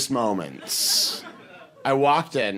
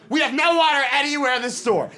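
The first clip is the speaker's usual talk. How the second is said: high, hurried, exasperated retort; escalating employee's retort; exasperated insistence; 9 semitones higher, faster than usual. Retold or imitated. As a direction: snap back at a stubborn customer, pitched high. Employee's reply escalates in retold quarrel.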